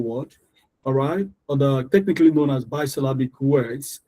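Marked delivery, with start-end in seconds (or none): none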